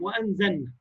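وأنزلنا with the lam merged into the nun; merged lam demo. Shown as a demonstration. Teacher shows the common mistake of merging the lam. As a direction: say وأنزلنا as if wenzenna, swallowing the lam. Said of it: "كتير من الناس تضغم".

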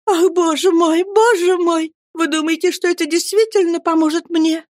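high, helpless babble; babbling. Acted. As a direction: lisp out a frightened plea. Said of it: "пролепетала матушка". (лепеча высоким голосом)

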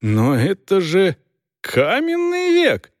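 slow, rising to a high incredulous cry; astonished. A narrator's reading. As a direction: stretch it out in disbelief. Dors aghast at printed book.

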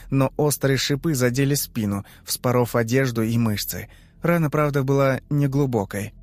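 ordinary delivery, nothing marked

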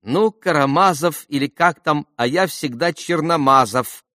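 high-pitched careless lady; dismissive wife. A narrator's reading. Wife brushing off the correction.

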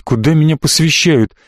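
usual speaking voice

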